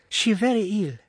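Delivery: high, indignant, broken English; a protective maid scolding her master; indignant foreign maid. A narrator's reading. Dolores tells her master the mistress is ill, indignant. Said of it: "cried the girl".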